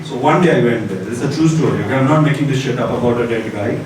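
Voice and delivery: ordinary talk, nothing marked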